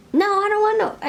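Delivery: high, loud, indignant no; flustered refusal. Retold or imitated. Listener rejecting the hotel room, high indignant blurt. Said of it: "repeating the flustered refusal".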